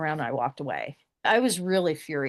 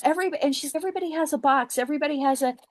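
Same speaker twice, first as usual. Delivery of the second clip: higher, faster insistent lady voice; insistent woman; 9 semitones higher, much faster than usual. Retold or imitated. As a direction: rattle it off like she can't believe you. Woman insisting everyone has box.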